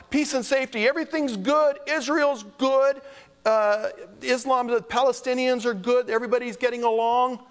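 sing-song parody of people insisting all is well; mocking the complacent world. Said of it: "they're crying out".

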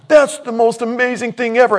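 high and loud, overwhelmed; amazed exclamation. Retold or imitated. Pastor reliving the guitar, loud high amazement. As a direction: gush about it like a kid.

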